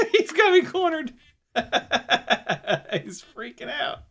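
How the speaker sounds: high-pitched, giggling through the words; laughing. Real, not acted. Dog has the drone cornered, real laughing. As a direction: talk through helpless laughter. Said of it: "I'm sure she can hear the laughter".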